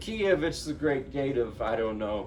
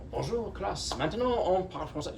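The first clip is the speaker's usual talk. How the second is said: put-on higher, fluent native French accent; perfect French; 7 semitones higher than usual. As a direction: switch into a teacher's flawless French, higher. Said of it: "Just perfect French".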